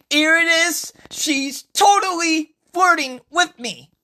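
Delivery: very high, loud excited outburst; thrilled squeal. Acted. Usuke sure Francesca is flirting, thrilled.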